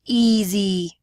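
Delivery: "Easy" with both syllables drawn out alike; equal syllable stress. Shown as a demonstration. Teacher recording the error to compare, "Easy" evenly stressed. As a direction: say "Easy" loud and even, equal weight on each half. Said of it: "kind of draws out the same".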